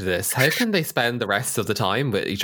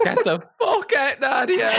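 high-pitched mock yell through laughter; imitated shouting; 12 semitones higher than usual. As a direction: join in the screaming imitation, laughing. Co-host joins the screamed imitation.

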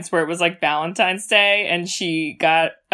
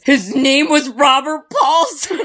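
slower, louder, higher mock-solemn quote; quoting the movie; 7 semitones higher, 6 dB louder, slower than usual. Sister quoting her favorite Fight Club line with a laugh. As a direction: intone it like a chant, laughing through. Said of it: "my favorite fucking quote".

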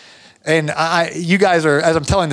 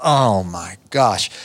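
much lower, slow dismay; dread; 9 semitones lower, much slower than usual. Retold or imitated. Worker watching a storm come at a broken window, low and slow dismay. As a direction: let it sink in slowly. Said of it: "I'm sitting here thinking".

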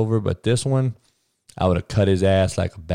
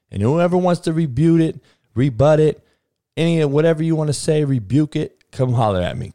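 higher and louder taunting dare; defiant challenge; 8 semitones higher, 4 dB louder than usual. Real, not acted. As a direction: throw the dare at the critics. Challenging critics to rebut.

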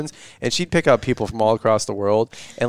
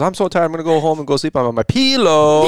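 higher-pitched country twang on "pillow"; mock country accent; 4 semitones higher than usual. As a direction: imitate a friend's rural accent. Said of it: "it's like Perry County coming".

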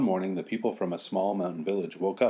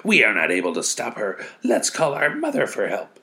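blizzard voice; 4 semitones higher, 6 dB louder than usual. Acted. Blizzard speaking.

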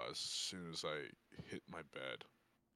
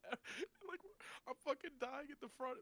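laughing; 14 semitones higher than usual. Real, not acted. Still laughing from the story.

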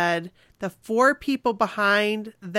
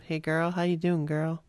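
mock smooth singer; 4 semitones lower, 6 dB quieter than usual. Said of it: "I just always picture him".

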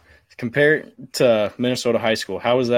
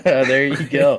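real amused laugh around the words; 3 semitones higher, 4 dB louder, much slower than usual. Real, not acted. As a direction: say it through a drawn-out laugh. Laughing at horseback riding plan.

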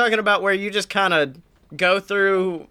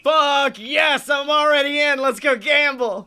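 excited shout; 7 semitones higher than usual. Real, not acted. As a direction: cheer like you just won. Excited reaction to slot machine.

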